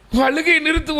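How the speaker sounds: the stern servant raises his voice at her; high scolding servant. Retold or imitated. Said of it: "ஊழியர் சொல்லுகிறார்".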